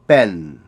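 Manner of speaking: "pen" with a loud explosive B. Shown as a demonstration. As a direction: burst the first sound of "pen". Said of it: "a very explosive B".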